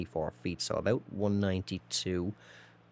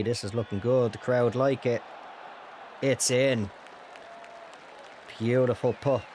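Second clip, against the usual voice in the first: golf commentator; 3 semitones higher than usual. Pretend. Mock TV golf call of a putt.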